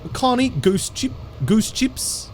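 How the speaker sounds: high, put-on New Zealand accent; imitated Kiwi accent. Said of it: "pretty bad at the Kiwi accent".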